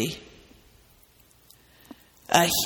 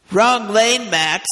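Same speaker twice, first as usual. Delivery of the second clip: calling out; 4 semitones higher, 6 dB louder, slower than usual. Acted. Attendant calling out to Mitty.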